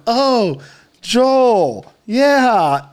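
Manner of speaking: put-on long high kid vowels; little kid. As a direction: imitate a child taking the correction. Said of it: "he's going".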